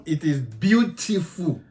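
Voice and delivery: "beautiful" said "beau-ti-fu" on purpose; his own pronunciation. Shown as a demonstration. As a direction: playfully insist on "beautiful" his way. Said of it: "From now on".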